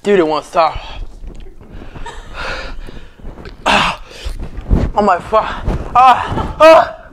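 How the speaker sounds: high, pained gasps, mouth on fire; mouth burning from spicy food. Real, not acted. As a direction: groan through the burning heat.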